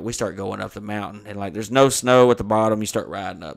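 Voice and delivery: normal talking voice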